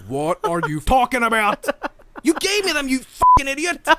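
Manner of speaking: acted-out furious protest; indignant outburst. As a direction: build from disbelief to an angry yell. Acted outburst at lying kid.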